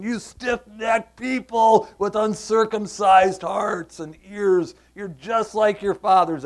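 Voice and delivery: forceful rebuke. Acted. Preacher reading Stephen's rebuke aloud.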